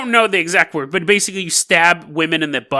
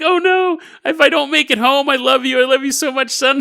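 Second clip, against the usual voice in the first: higher, pleading farewell, hammed-up; mock dying mom; 7 semitones higher than usual. Mom calling her son, hammed-up and pleading. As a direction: ham up a tearful goodbye to her son. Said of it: "she's like".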